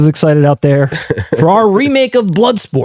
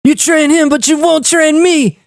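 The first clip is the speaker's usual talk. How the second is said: higher, hammed-up kid voice; performed on request, higher and slower; encore kid impression; 9 semitones higher than usual. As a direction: whine it like the young Frank Dukes. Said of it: "They want an encore".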